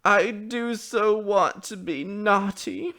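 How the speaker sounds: put-on high, slow, loud childish wail; sobbing. A narrator's reading. Child confesses to her mother, sobbing. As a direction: wail it long and drawn out. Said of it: "she sobbed".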